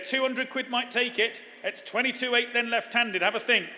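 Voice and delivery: ordinary delivery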